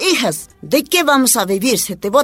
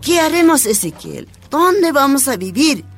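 high, anxious questioning; worried, higher-pitched appeal; distressed wife; 6 semitones higher than usual. Acted. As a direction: plead with your husband for an answer. Wife despairing over having no home.

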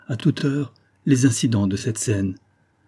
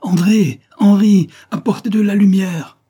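very high, very loud shout for the servants; shouting with all her might; 10 semitones higher, 8 dB louder than usual. Acted. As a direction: yell down the house for light. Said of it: "elle cria de toute sa force".

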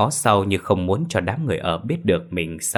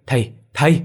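louder, rising panicked "thầy, thầy!"; frightened cry; 6 semitones higher, 5 dB louder than usual. Acted. Son seeing ghosts, loud frightened "thầy" calls. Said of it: "thằng Quang lắp bắp".

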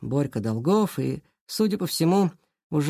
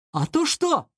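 high, cocky comeback; haughty challenge; 10 semitones higher, slower than usual. A narrator's reading. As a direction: challenge him, voice tilted up. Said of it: "Власов надменно задрал подбородок". (надменно, с вызовом)

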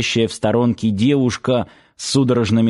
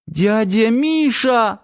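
high, drawn-out scared cry; frightened squeak; 11 semitones higher, slower than usual. A narrator's reading. Frightened girl calling for Uncle Misha, squeaking. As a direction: squeak it out in fear. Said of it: "испуганно пискнула Лера".

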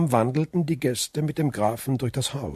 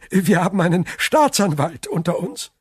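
higher, amused tease; laughing; 6 semitones higher, 3 dB louder than usual. A narrator's reading. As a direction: keep the chuckle in the voice. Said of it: "lachte Frau von Villefort". (amüsiert, lachend)

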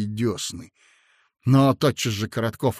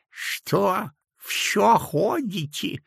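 put-on higher, slow toothless old man mumbling; gumming soft food; 8 semitones higher, much slower than usual. A narrator's reading. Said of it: "зашамкал он". (шамкая, как беззубый старичок)

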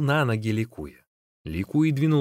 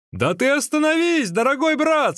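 very high, insistent call; 14 semitones higher, 4 dB louder than usual. A narrator's reading. Magician teases runner to stop and eat, calling out. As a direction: urge him to halt, voice pitched right up.

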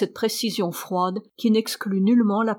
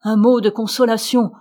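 usual speaking voice

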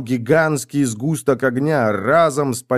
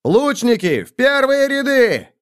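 very high, drawn-out battle command; shouted order; 13 semitones higher, 3 dB louder than usual. A narrator's reading. Prince sends archers to the front, long high shout. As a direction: call it out slowly over the whole field. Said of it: "отдал приказ принц".

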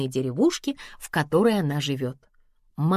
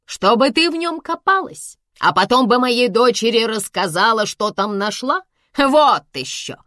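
raised, scornful retort; indignant bark; 5 semitones higher, 4 dB louder than usual. A narrator's reading. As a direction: snap back at the housekeeper. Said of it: "рявкнула мама".

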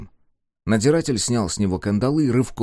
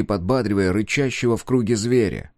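ordinary delivery

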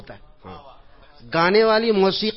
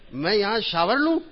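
shocked, higher and louder question; aghast; 5 semitones higher, 5 dB louder than usual. Retold or imitated. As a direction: recoil at the idea. Host offers shower, speaker aghast. (حیرت سے چونک کر سوال)

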